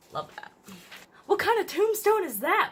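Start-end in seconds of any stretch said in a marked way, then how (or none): 1.3-2.7 much higher and louder, shocked. A narrator's reading. Molly reacting to the strange tombstone, shocked. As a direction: cry it out in disbelief. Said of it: "Molly said in shock".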